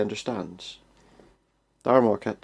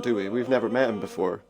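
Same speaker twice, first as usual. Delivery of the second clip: ordinary talk, nothing marked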